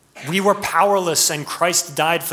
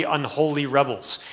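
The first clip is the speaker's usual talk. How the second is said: usual speaking voice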